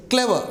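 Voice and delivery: "clever" with the first syllable punched high, falling away; stress on first syllable. Shown as a demonstration. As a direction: hit the start of "clever", let the end drop. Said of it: "You have to give a stress".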